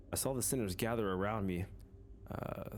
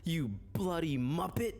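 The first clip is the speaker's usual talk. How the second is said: higher, mocking British accent with a laugh breaking in; angry British Sinclair; 6 semitones higher than usual. Sinclair snapping at the manager, angry British accent. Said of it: "I can't picture Sinclair British".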